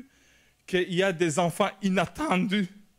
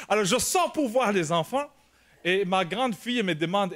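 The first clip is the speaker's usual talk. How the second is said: normal talking voice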